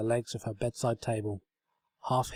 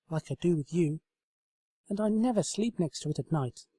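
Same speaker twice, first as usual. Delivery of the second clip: put-on higher, earnest young mare; sincere, eager explaining; higher pony voice, earnest; Twilight voice; 7 semitones higher than usual. A narrator's reading. Twilight's voice in story.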